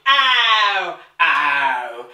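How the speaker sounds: mock her ugly "Ow" sound, drawn out; mocking cockney wail. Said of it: "murder of the English tongue".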